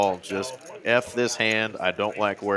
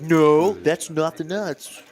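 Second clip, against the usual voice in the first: mock beginner; 8 semitones higher, 5 dB louder, slower than usual. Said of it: "It's like".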